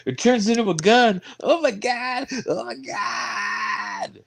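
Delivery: high, rising mock shriek; mock panic. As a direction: freak out in a hammy way. Mock panic about toy gun.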